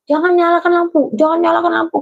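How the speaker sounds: retold cry. Friend warning not to light the lamp, mock cry. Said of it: "tiba-tiba dia teriak".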